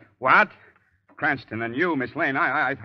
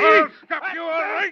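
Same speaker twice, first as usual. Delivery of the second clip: shouting; 6 dB louder than usual. Acted. Commissioner struggles with villain.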